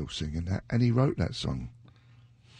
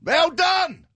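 excited praise; 7 dB louder than usual. Real, not acted. Praising listener's correct guess.

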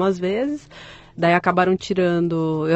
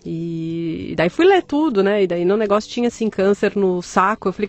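normal talking voice

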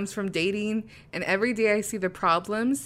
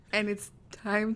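nervous laughter; 4 dB quieter, slower than usual. Real, not acted. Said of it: "my laughing is my nervous tick".

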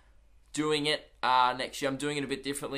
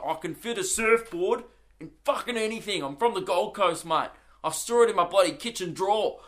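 proud Gold Coast bloke; 5 semitones higher than usual. Retold or imitated. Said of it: "Just the resilience to be like".

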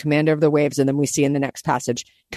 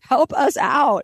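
slow, drawn-out mock shout, higher; hammed-up disciple yelling at sleeping Jesus; frantic cry for help; 8 semitones higher, slower than usual. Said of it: "So the disciples are like".

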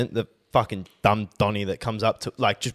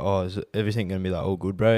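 timid retold question; 5 semitones lower, 5 dB quieter, faster than usual. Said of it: "he's like".

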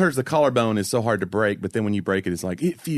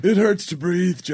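high, pained injured-player voice; gravely ill Tony Romo; 5 semitones higher than usual. Pretend. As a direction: sound like a broken man who can barely breathe. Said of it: "Your Tony Romo voice".

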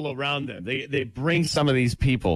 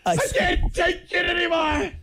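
mock outburst. Mocking upset tennis player.

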